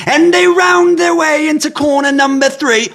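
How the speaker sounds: very high, loud mock commentator; British race announcer. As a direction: call the race in a bad British accent. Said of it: "we're never letting him do the announcements".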